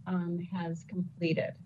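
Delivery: usual speaking voice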